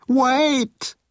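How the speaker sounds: sudden high-pitched cry; blurted out. A narrator's reading. Moan interrupts the torpedo order, blurting it out. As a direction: blurt it before you can stop yourself. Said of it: "Moan had blurted it out".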